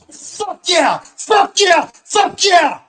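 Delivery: very high, full-force ecstatic shout; triumphant scream. Retold or imitated. Man jumping up after blacking out, screaming. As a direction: scream it out at the top of your lungs. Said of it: "I screamed".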